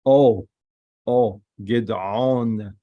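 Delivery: "O" sounded alone, then drawn out in "Gidon"; long O sound. Shown as a demonstration. Teacher showing the dot above the Vav, long O sound. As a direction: isolate the "O", then stretch the end of "Gidon". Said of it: "it comes Holem".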